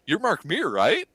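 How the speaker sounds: high, eager question to a star; excited. Retold or imitated. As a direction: starstruck and breathless asking. Fan stops her hero excitedly.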